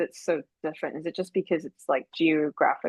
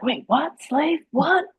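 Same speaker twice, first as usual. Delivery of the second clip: high-pitched, incredulous retold reaction; mock shocked disbelief; 10 semitones higher, slower than usual. Retelling someone's startled reply to being told, in mock disbelief. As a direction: act out a startled double take, pitch climbing.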